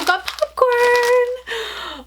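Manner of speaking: high, drawn-out squeal of delight; excited squeal. Real, not acted. Unboxer finding surprise popcorn, squealing with delight. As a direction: stretch the last word into a high squeal.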